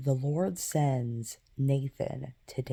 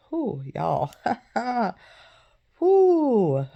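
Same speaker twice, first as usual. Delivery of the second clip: high, loud shaky exhale while overcome; mother recalling her daughter's death, letting out an emotional whew; 9 semitones higher, 8 dB louder, much slower than usual. Real, not acted. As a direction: let the breath out, fighting tears. Speaker recalls losing her daughter.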